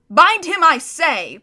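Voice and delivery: high, loud, impatient order; angry command. Acted. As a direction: bark the order again. Witch repeating her command.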